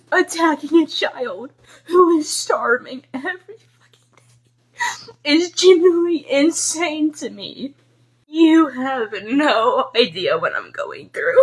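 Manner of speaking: crying whisper. Real, not acted. Teen repeats to commenters attacking a starving child is insane, whispering tearfully. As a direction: barely get it out through the crying.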